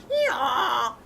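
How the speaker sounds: a big braying hee-haw, loud and proud; loud donkey bray. A narrator's reading. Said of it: "his voice was the loudest in the farmyard".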